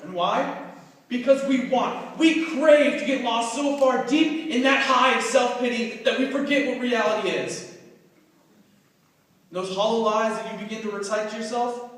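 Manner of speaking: forceful spoken word surging to loud peaks; impassioned slam poetry. Acted. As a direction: perform it like an urgent, accusing speech. Recites original slam poem.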